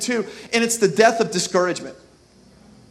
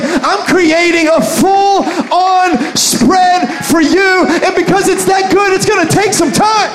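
loud, high, slow shout; loud joyful shout; Jesus proclaiming; 9 semitones higher, 8 dB louder, slower than usual. Retold or imitated. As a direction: proclaim it like a feast is coming. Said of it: "Jesus is like".

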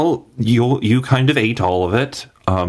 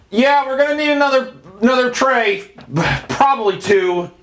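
much higher, fast, resigned worker voice; squeaky tired cook calling for more trays; weary worker. Acted. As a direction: cafeteria worker resigned to the hungry guest.

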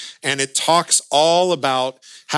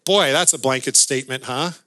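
usual speaking voice

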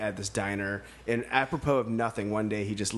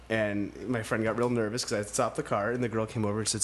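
normal talking voice

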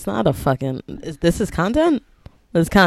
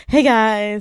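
louder YouTuber greeting; YouTuber intro; 5 semitones higher, 6 dB louder than usual. Pretend. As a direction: open like a vlogger. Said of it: "I'm gonna do my YouTuber intro".

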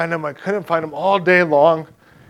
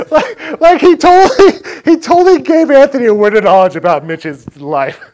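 excited; 7 semitones higher, 7 dB louder than usual. Real, not acted. Amazed at God's prophetic word.

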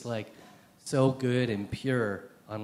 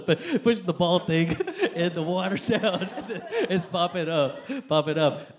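laughing through the words; words bubbling with giggles; 6 semitones higher, 4 dB louder than usual. Real, not acted. Speaker to audience, laughing at forgetting the word. Laughing while describing the metaphor.

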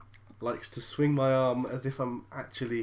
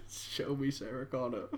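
robot voice; 7 dB quieter than usual. Pretend. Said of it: "[name]'s a robot".

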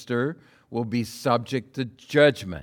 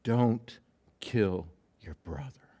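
hushed pleading; 2 semitones lower, 5 dB quieter, slower than usual. Acted. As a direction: beg him quietly, with a pause after the first word. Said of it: "comes to Cain and pleads with".

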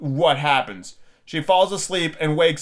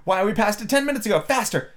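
high, quick urging; hurried, high-pitched exclamation; urgent Queen; 6 semitones higher, faster than usual. A narrator's reading. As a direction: rush it out high, driving someone onward. Queen urging Alice to run.

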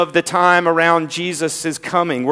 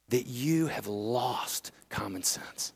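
hushed whisper; 5 semitones lower, 14 dB quieter, slower than usual. Real, not acted. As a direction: whisper it slowly, almost under the breath. Pastor's pointed sermon question.

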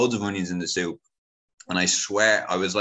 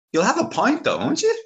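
much higher, louder coaxing uncle; insistent uncle; 5 dB louder than usual. Retold or imitated. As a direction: push the pint, voice pitched up. Uncle pressing him to drink.

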